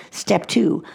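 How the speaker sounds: usual speaking voice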